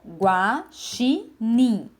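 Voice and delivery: GUAXINIM in three slow beats, "gua - xi - nim"; dividido em sílabas. Shown as a demonstration. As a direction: say Guaxinim syllable by syllable, with pauses. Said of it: "quantas partes tem a palavra". (Guaxinim separado em sílabas, gua... xi... nim)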